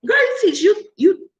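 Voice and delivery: very high-pitched, excited disbelief; amazed squeal. Acted. Creator reacts to follower's coupon offer, amazed squeal.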